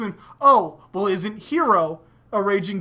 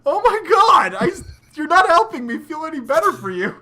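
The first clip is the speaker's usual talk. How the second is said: exasperated cry; 6 semitones higher, 4 dB louder than usual. Retold or imitated. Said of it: "it's like".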